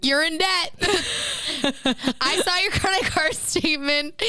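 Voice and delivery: high mock accusation, laughing midway; joking scolding. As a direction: tease as a scandalised parent. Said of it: "like, as a joke".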